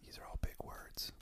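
unvoiced joking aside; whisper. Real, not acted. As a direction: whisper it mockingly.

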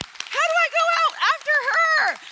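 high, loud comic squeal of dread; mock dread. Speaker retelling her dread of following Amber, mock squeal. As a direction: squeal it like a nervous kid. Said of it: "I'm like".

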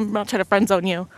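ordinary talk

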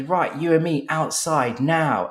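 high, slow mock challenge; retold shouting. Tom challenging the client to step outside, slow mock shout. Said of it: "they start shouting at each other".

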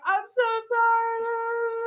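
high, loud, drawn-out mock sorry; wailing apology. Imitating a teenager apologizing to mom, exaggerated wail. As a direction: stretch it into a sing-song whine of apology.

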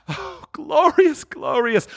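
high, long drawn-out exultation; rapturous. Acted. Scrooge hearing the church bells, rapturous high cry. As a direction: swoon with joy over the words.

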